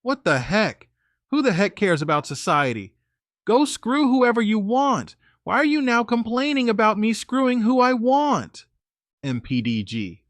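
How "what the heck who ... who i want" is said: angry, escalating retort; angrier. A narrator's reading. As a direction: build from irritation to a raised-voice rant.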